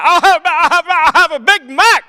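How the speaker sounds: high, loud preaching cadence with laughs; preacher ordering. Retold or imitated. Preacher dad ordering at McDonald's, loud and high. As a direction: order a burger like a sermon. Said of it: "Not for my dad".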